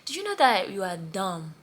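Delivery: put-on loud Nigerian-accented scolding, knoW stretched down. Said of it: "In Nigerian accent".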